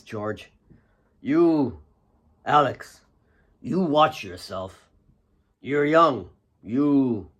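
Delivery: lightly voiced old miner, a touch weathered; a hint of a fatherly old hand; old miner's voice. A narrator's reading.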